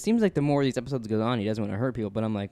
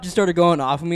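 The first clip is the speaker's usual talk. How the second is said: ordinary delivery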